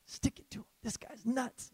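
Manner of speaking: hushed, quick aside to yourself; inner whisper. Retold or imitated. Thinking it to yourself, cheering in a hushed whisper. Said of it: "you're kinda inside, you're like".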